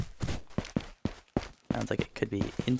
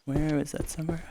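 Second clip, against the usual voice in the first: whispered question while sneaking; whisper, don't give away position; whispering while hiding; 5 semitones higher than usual. Real, not acted. Searching for the shooter quietly.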